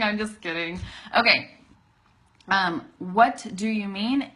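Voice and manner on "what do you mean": slow, louder, separated words. Shown as a demonstration. Teacher showing the form to avoid, word by word. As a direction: give each word of the question its full sound. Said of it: "Instead of saying it all like that".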